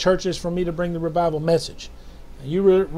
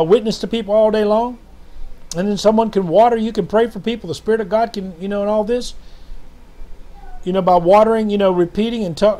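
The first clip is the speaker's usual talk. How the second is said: normal talking voice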